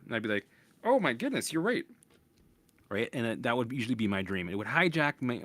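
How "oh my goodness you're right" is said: high-pitched gasp of dismay; a jolt of alarm at the news; mock shocked realization. Dreaming man to doctor, pitched-up mock shock.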